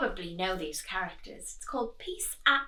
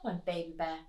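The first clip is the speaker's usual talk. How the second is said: usual speaking voice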